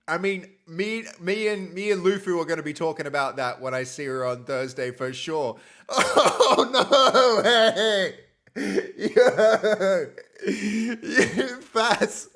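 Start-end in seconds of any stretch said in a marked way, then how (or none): 5.9-12.3 loud laughing surprise; laughing excitedly. Real, not acted. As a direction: laugh through the words. Surprise donation reaction.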